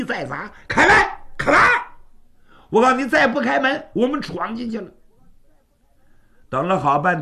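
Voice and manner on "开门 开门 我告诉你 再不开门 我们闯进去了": loud, high, urgent yelling at a door; shouting to open up. Acted. Servant shouting at the locked door, loud and high. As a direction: pound the door and bellow.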